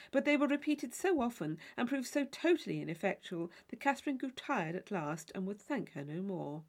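ordinary delivery

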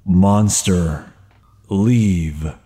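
low, ominous dwarf voice; deep, halting menace, word by word; heavy-breathing dwarf. Acted. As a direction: drop low and slow into the mic. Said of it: "Skit in a microphone breathing heavily".

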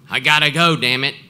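loud, annoyed snap; angry. Retold or imitated. The strong man cutting off his call, loud and annoyed. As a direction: hang up in a temper.

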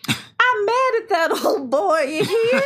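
Charlene impression. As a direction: whine it like a hurt Southern woman. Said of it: "my Charlene impression".

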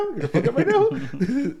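mock taunt breaking into a laugh; mocking inner voice, laughing. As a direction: crack up while taunting yourself. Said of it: "a little, like".

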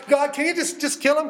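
a touch of exasperated pleading; raw plea. Acted. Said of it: "that raw and real emotion".

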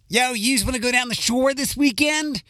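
high-pitched Philly street question; Philly accent. Shown as a demonstration. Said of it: "some of my Philly accent".

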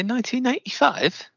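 high incredulous question; catching a guest in a slip, amazed; surprised query. Acted. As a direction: pounce up high on the year. Interviewer catches the wrong year.

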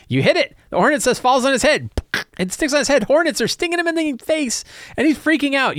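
high-pitched, animated play-by-play of chaos; excited game master. Acted. Game master tells a player their shot hit the hornet's nest, excited. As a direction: narrate the mayhem in a high, worked-up voice.

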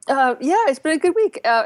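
ordinary talk, nothing marked

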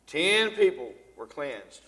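ordinary delivery

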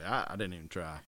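normal talking voice

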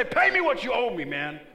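high, loud shouted demand; loud preaching, creditor yelling; furious demand. Pretend. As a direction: shout like grabbing someone's throat. Preacher acting as harsh creditor.

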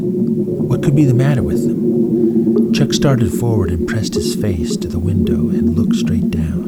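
lightly dramatic storybook reading; story narration. A narrator's reading. Narrator reading a space adventure to listeners, lively storytelling. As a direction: tell it like a bedtime adventure.

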